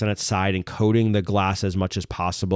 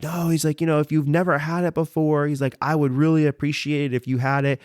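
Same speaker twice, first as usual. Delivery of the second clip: higher-pitched imitation of an earnest host; earnest urging; 7 semitones higher than usual. Store owner urging a guest to try it, lightly imitated, higher earnest voice. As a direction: lightly voice someone pressing a gift on you.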